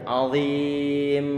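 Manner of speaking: azim with the m pulled long; drawn-out final m. Shown as a demonstration. Teacher shows a student how to draw out the mim in azim. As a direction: stretch the closing m of azim. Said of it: "Ditarik sedikit".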